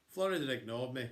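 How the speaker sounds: plain reading voice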